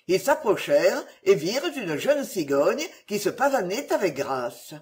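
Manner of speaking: plain reading voice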